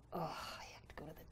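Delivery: whispered, dreading it; hushed, unwilling whisper; reluctant whisper. Shown as a demonstration. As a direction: breathe it out like you dread going. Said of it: "or it could be".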